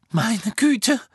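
high, loud startled cry; frightened shriek. A narrator's reading. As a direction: cry out in alarm, voice shooting up. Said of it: "hörte Harry Hermine neben sich verängstigt kreischen". (verängstigtes Kreischen)

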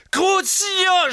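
high, loud screamed curse; geschrien. Acted. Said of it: "schrie Harry". (den Fluch schreien)

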